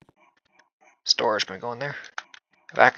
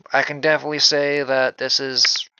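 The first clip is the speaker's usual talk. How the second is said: normal talking voice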